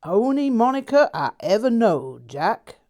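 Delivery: southern drawl. A narrator's reading. Narrator answering Jack in a put-on Southern drawl. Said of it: "I said in a southern drawl".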